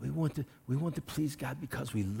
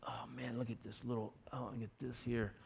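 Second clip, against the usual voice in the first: much softer, dismayed look at your own flaws; mock muttering at the mirror; 2 semitones lower, 12 dB quieter than usual. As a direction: murmur it to your reflection, disappointed.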